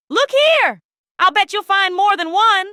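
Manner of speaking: playful searching. Acted.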